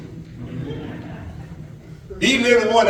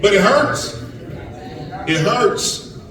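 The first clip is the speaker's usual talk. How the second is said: ordinary talk, nothing marked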